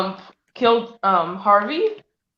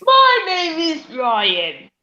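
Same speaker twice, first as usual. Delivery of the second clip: slow put-on voice; 6 semitones higher, 3 dB louder, much slower than usual. Acting out the ship characters.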